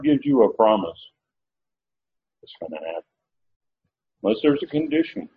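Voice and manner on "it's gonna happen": dropped to near-silent, low and soft; sudden hush. Acted. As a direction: let it fall to a hush of certainty. Preacher dropping voice for conviction.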